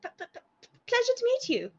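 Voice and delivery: put-on high, nervous stammering girl; a startled girl stuttering her greeting; shy stammer. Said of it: "with sort of wide eyes and says".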